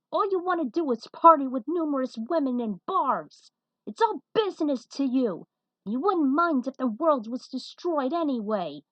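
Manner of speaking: loud, high, scornful rant; angry challenge. Acted. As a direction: tell him off with contempt. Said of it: "The firebrand challenged".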